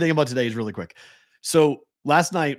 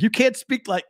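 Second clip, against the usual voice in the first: mock scolding parent; 10 semitones higher than usual. Dad pretending to scold his daughter, high mock scold. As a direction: start to scold the kid, hammed-up. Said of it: "I wanted to get mad at her".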